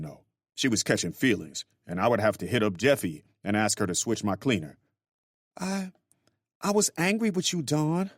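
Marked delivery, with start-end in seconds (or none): 5.6-8.1 cooing. Acted. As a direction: coo it sweetly to him. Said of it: "she cooed".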